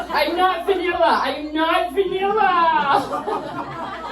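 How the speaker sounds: high, very slow laughing insistence; high drawn-out defiance. Retold or imitated. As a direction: drag it out, protesting too much. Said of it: "I'm like".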